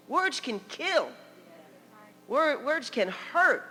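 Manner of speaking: slow, weighty warning; deliberate, drawn-out preaching line; grave emphasis. Real, not acted. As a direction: draw out each word for emphasis. Preacher driving home a warning.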